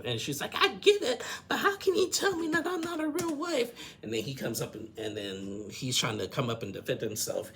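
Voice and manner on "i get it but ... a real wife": high-pitched mock outrage; raised voice, exasperated retelling; indignant protest. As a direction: act out an upset wife protesting. Acted protest of upset wife.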